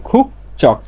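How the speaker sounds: খুক চক in two separate beats, voiceless ch opening চক; corrected ch reading. Shown as a demonstration. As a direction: say খুক চক with ch in place of j. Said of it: "উচ্চারণ এরকম হবে".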